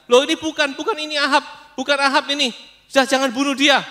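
high, loud shouts of realization; alarmed shouting. Acted. Soldiers shouting to each other on the battlefield, loudly alarmed. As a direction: call off the attack at once.

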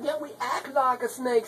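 ordinary delivery

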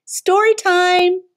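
high, slow inviting call; sing-song call. Acted. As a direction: call out like a storyteller. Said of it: "says a voice from the shadows".

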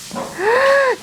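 sharp high delighted gasp. Real, not acted.

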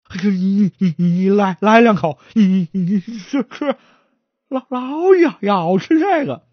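stammering, tripping over every word; a nervous stutterer begging to lead the way. Acted. 结巴的伙计结结巴巴地求着带路.

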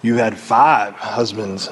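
low, slow, unjudging statement; gentle truth-telling. Acted. Jesus telling the woman the truth, without judgment. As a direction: lay out the truth plainly, unhurried. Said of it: "He just told her the truth".

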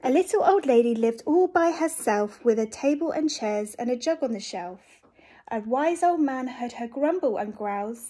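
lively read-aloud storytelling; reading to children. A narrator's reading. Reads a picture book aloud.

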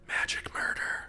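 soft, theatrical whispered catchphrase; hammed-up whisper. Gamer trying out a stealth-kill catchphrase in a hammed-up whisper. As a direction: whisper it like a mock assassin.

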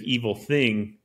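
ordinary delivery

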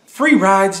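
high, loud, mocking disbelief; incredulous echo. Real, not acted. Scoffing at the woman in the clip about free rides, high incredulous echo.

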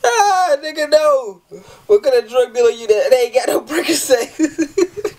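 very high, wheezing with laughter; helpless high-pitched laughing; cry-laughing. Real, not acted. Reacting to a funny text, cry-laughing.

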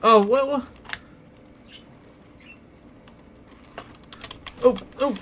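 high startled cries; startled yelp. Real, not acted. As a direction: jump at a sudden scare. Startled by enemy in game.

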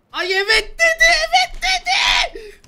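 very high, loud falsetto shriek; mock girly squeal. Shrieking yes to the streamer's proposal in mock falsetto. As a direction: squeal it like a giddy bride.

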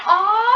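sudden high yelp of fright; startled high scream. Real, not acted. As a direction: yelp as the enemy hits. Panicked scream in game.

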